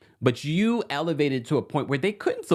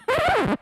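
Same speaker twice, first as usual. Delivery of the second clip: high laugh. Real, not acted. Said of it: "high laugh after squeal".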